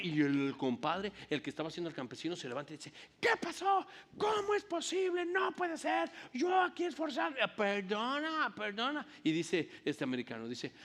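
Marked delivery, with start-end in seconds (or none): 3.2-7.4 exasperated shout. Retold or imitated. Frustrated actor protests the cut. 7.5-9.1 put-on higher American accent, apologetic; mock American accent in Spanish. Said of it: "y con mucho acento".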